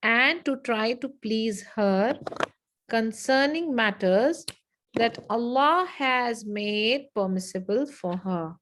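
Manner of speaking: slow, drawn-out dictation; slow repeat. Shown as a demonstration. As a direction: give it phrase by phrase, unhurried.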